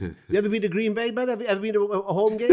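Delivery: high-pitched Valleys Welsh questioning; Pontypridd-Merthyr accent. Retold or imitated. Packers fan quizzes visitor about Green Bay, in Pontypridd accent. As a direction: ask like an excitable Pontypridd lad. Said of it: "I'll do him as Pontypridd".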